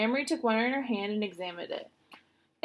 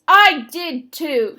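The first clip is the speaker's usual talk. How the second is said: much louder, slow, defiant insistence; belligerent; 6 semitones higher, 15 dB louder, much slower than usual. A narrator's reading. Little sister insisting to her big sister, belligerent and loud. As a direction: stand your ground, slow and loud. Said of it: "she said belligerently".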